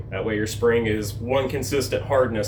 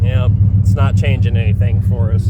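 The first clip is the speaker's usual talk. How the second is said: raised voice over engine; 2 semitones higher, 8 dB louder than usual. Real, not acted. Speaking over the running engine.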